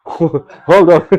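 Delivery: amused laughing voice; laughing hold on. Retold or imitated. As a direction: tease him while laughing. Ram stops eager Hanuman.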